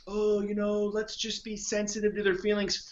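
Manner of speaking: high, put-on sensitive whine; mocking prissy voice. Sneering at coaches who protect fat clients' feelings, prissy mock voice. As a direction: parody the gentle coach tiptoeing around feelings.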